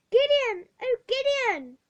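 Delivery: put-on girl shouting after her brother; shouted. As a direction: call after your brother. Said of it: "I shouted".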